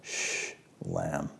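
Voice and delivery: soft shh then a low, hushed lamb; hushed shalom sound. Shown as a demonstration. Preacher softly sounding out shalom as a hush. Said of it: "it sounds like".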